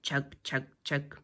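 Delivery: low soft chug chug chug; low rumbling tractor chug; soft low engine chugging. Acted. Tractor sound effect.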